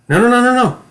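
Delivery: high, loud frightened no's; panicked. Shown as a demonstration. Acting coach demonstrates panic to students. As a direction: recoil in terror. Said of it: "I want you to watch me".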